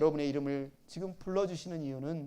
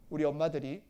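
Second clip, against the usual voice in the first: ordinary talk, nothing marked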